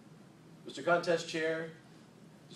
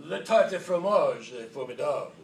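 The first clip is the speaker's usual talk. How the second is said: louder hammed-up French phrase; mock French accent; 6 dB louder than usual. Shown as a demonstration. Speaker reciting his French vocabulary in a mock accent. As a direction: declaim it like a fake Parisian. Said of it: "my entire French vocabulary".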